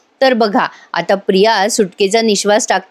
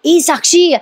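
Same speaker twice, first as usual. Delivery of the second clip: higher call across the room; 6 semitones higher, slower than usual. Retold or imitated. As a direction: call her name to get attention. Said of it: "आवाज देत असते आधी साक्षीला".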